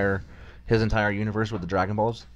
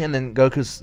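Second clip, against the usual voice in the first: ordinary talk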